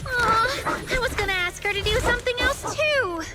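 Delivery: high-pitched, let-down complaint; disappointed protest. Acted. Let down as illusion falters.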